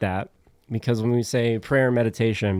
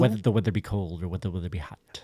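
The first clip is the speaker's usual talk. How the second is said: fast tongue twister; 2 semitones lower, 3 dB quieter, faster than usual. Shown as a demonstration. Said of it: "I will do tongue twisters".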